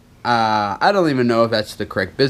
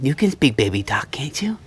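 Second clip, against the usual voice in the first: whisper. Real, not acted. Whispering to baby.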